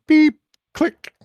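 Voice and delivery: high electronic beep and click; imitated door sounds. Game master voices the door lock, imitated beep and click. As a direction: mimic a keypad beep, then a click. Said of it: "You hear".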